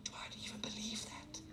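unvoiced aside to yourself; a private whispered question; whispered doubt. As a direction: whisper the doubt under your breath.

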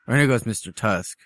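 ordinary delivery

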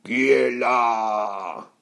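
long drawn-out troll growl; menacing beast, very slow. Acted. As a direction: growl it slowly, stretching each word. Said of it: "grogna la bête".

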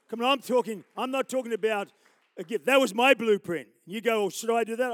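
loud fervent preaching climax; preaching at full force. Acted. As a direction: proclaim it to the whole hall.

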